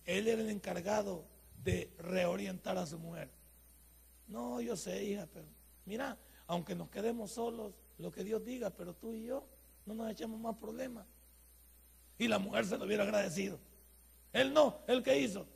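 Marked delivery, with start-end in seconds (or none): 4.3-11.1 much softer, tender reasoning; gentle husband. Retold or imitated. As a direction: soothe your wife kindly. Ideal husband speaks gently.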